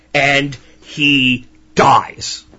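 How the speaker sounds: very slow, grave final words; solemn slow ending. A narrator's reading. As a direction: let it land heavily, slow. Grasshopper's death in fable.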